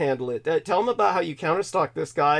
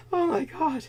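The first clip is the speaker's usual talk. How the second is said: usual speaking voice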